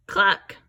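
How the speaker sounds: loud clucking hen. Shown as a demonstration. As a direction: cluck like a chicken for the class. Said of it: "Who says cluck?".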